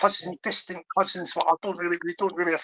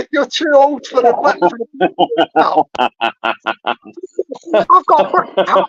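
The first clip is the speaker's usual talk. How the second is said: laughing teasing; 6 dB louder than usual. Real, not acted. Teasing friend about his hat.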